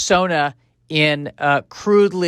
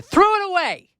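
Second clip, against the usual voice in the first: accusing outburst. Acted. Caveman accuses partner.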